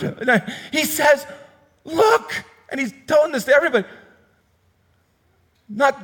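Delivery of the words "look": excited call. Retold or imitated. John points Jesus out to all.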